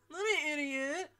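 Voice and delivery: high loud indignant protest; offended mock outburst. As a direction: protest like an insulted cartoon. Acted quarrel, offended.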